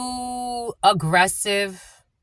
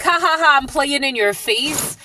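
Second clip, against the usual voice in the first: mocking laugh; 5 semitones higher than usual. Mocking thief voiced in retelling.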